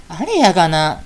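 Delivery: normal talking voice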